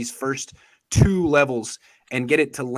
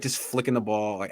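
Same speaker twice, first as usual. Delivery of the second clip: ordinary talk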